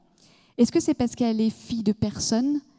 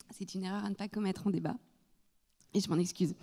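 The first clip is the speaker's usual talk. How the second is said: talking after swallowing wrong; quiet, throat still catching; after choking; 10 dB quieter, faster than usual. Real, not acted. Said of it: "J'ai avalé de travers".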